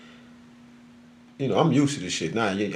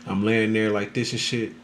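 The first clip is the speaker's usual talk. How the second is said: ordinary talk, nothing marked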